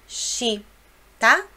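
normal talking voice

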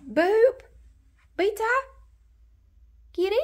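high loud call to the cat. Real, not acted. Calling the cat indoors, high and loud. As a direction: call her across the house.

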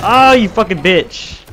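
stretched, loud furious shout, pitched high; drawn-out gamer rage yell; angry yell. Real, not acted. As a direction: scream your rage at the screen. Raging at game opponent.

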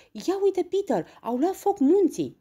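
high, loud astonished cry; excited exclamation. A narrator's reading. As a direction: call out urgently about an amazing sight. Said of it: "exclamând".

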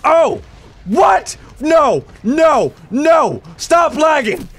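high, loud panicked shouting; panicked outburst. Real, not acted. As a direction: freak out in short, high bursts. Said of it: "Sorry for my panic attack".

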